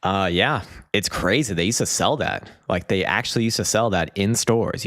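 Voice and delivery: ordinary talk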